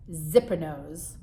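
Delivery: plain reading voice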